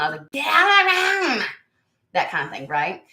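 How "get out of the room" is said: high, loud yelling; retold yell. Mother yelling at son to leave the room. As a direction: scream it at the intruding son. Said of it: "I kept yelling".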